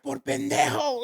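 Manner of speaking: high-pitched imitation of mother; mock scolding mom. Mom calling her son a fool, high mock scolding.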